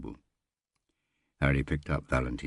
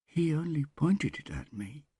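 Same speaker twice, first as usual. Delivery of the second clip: mechanical whisper; 13 semitones higher than usual. A narrator's reading. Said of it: "Smith whispered, mechanically".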